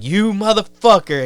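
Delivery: mock an angry cop catching someone. Cop to a hiding man he spots, angry. Cop spotting him, acted.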